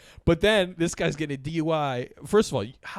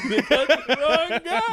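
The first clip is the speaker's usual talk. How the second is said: higher, slow, laughing through; 12 semitones higher, slower than usual. Retold or imitated. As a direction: laugh it out in mock disbelief. Mocking drunk driver.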